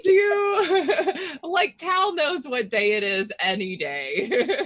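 words through real laughter; giggling while teasing; laughing. Real, not acted. Player laughing while teasing about Tal knowing the day.

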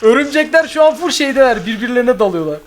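high giggling while talking; laughing. Real, not acted. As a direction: laugh through the words. Laughs at spiders.

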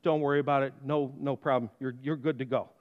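low, soft, kindly judge. Retold or imitated. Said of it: "And judge finally says".

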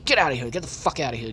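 normal talking voice

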